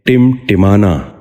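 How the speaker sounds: ٹمٹمانا with short i's, "timtimana"; short i after each ٹ in ٹمٹمانا; correct pronunciation. Shown as a demonstration. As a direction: say ٹمٹمانا as "tim-ti-ma-na". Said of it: "آپ بھی غور سے سنیے".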